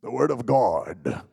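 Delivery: slow, loud mock preacher boom; mock serious preacher. Imitating a serious preacher reading scripture, slow mock boom. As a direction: ham up a solemn preacher.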